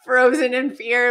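ordinary talk

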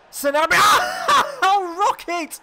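very high, very loud drawn-out scream of the name; ecstatic shout. Real, not acted. Gamer as his striker scores, ecstatic screaming. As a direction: scream it with pure joy, laugh breaking in.

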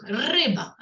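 "риба" with a long trilled р; rolled first sound. Shown as a demonstration. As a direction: hold the opening р in "риба". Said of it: "перший звук".

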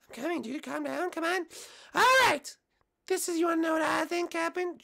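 high, loud cartoon mushroom voice; squeaky eager little guy; Toad puppet voice. Toad answering the host.

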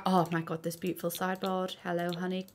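soft, adoring coo; cooing softly. Real, not acted. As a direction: coo at it like a pet. Cooing at a sideboard.

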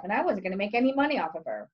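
ordinary delivery, nothing marked

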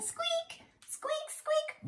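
tiny high squeaky mouse; mouse squeak. Acted.

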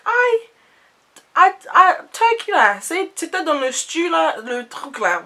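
high, loud Ivorian auntie, indignant. Retold or imitated. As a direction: play the baffled auntie, pitch way up. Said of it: "And she's like".

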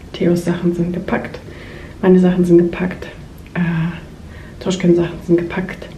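usual speaking voice

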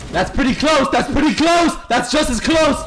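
high-pitched, repeated excited call; excited exclaiming. Real, not acted. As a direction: exclaim it high, each time insistent. Reacting excitedly to close game action.

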